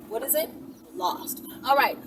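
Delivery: normal talking voice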